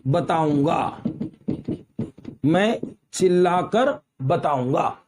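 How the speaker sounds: slow dictation with long gaps; measured, word by word, keeping pace with writing; dictating. Shown as a demonstration.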